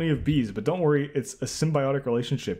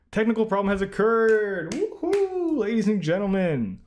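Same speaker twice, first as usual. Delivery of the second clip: much higher, louder mock fanfare; mock announcer cheer; 11 semitones higher, 4 dB louder than usual. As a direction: hype up the glitch like a showman. Mock celebrating a quiz error.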